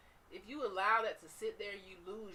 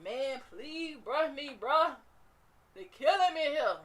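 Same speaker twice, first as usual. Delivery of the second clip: higher, much louder Bernie Mac-style plea; 6 semitones higher, 11 dB louder than usual. Acted. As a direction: yell it like a comedian begging. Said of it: "In my Bernie Mac voice".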